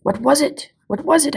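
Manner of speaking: flustered, excited asking; eager old man. A narrator's reading. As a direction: demand to know what just happened. Old doctor missed the hit.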